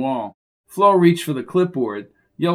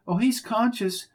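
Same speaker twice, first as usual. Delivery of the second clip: squeaky voice; 7 semitones higher than usual. Acted. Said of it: "said a squeaky voice".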